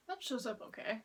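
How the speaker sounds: usual speaking voice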